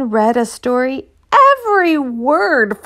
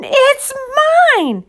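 put-on insistent boy; 5 dB louder than usual. Children quarrel over camera.

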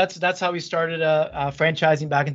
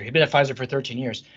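ordinary talk, nothing marked

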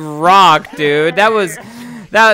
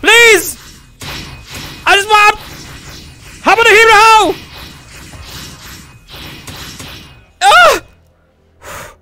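panicking gamer; 11 semitones higher, slower than usual. Real, not acted. Low health in fight.

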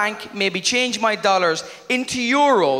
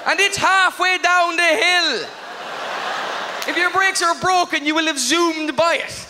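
exasperated rant; 9 semitones higher than usual. Real, not acted. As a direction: bellow it out in disbelief. Ranting about the road sign.

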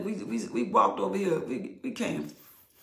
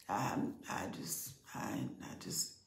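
barely audible, much softer and lower; broken whisper; 6 semitones lower, 13 dB quieter than usual. Real, not acted. Preacher overcome before congregation, whispering. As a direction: trail off into a breaking whisper.

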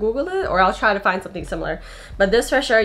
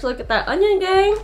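gushing; 11 semitones higher than usual. Real, not acted. Gushing over friend's merch.

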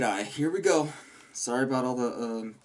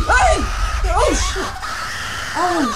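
real yell, much higher and louder, breaking into a laugh; panicked shout; 8 dB louder, much slower than usual. Real, not acted. Player yells at a lunging monster, panicked shout then laugh.

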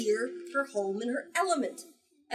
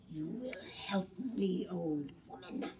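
menacing whisper; 6 dB quieter than usual. Acted. The Jumbi commanding the witch in a slow menacing whisper. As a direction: breathe the threat slowly.

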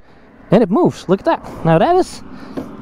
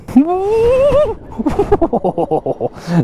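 high excited laugh; 14 semitones higher than usual. Real, not acted. Surprised by the speed.

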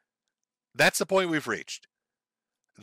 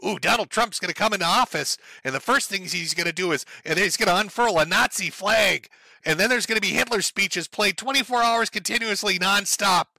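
higher, breathless mock panic; sneering at the nightmare scenario, pitched up; mocking alarmist; 4 semitones higher than usual. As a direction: ham up the doom-monger's fear. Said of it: "your fairy tales and nightmare scenario shit".

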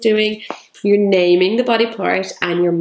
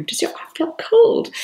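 put-on high whispered question to a toddler; hushed, playful whisper to the child; soft play whisper; 12 semitones higher than usual. Shown as a demonstration. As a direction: whisper it like a secret game. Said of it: "put it on your arm and say".

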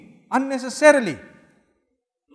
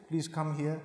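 gentle request; 6 semitones lower, 10 dB quieter than usual. Shown as a demonstration. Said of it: "So then you got to say".

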